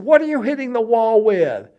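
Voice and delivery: high, loud mimicked demand; retold shout back. Wife on the bed to husband, high retold shout.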